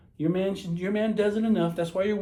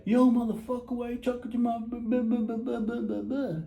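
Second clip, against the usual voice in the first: raised-pitch griping that runs into babble; mock jealous rant; 5 semitones higher than usual. Jealous man to the guy chatting up his woman, mock rant. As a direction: imitate a man blustering at a rival.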